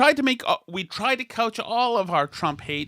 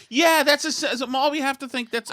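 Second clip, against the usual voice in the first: mock enthusiastic agreement; 9 semitones higher than usual. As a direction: gush in mock agreement, pitched way up.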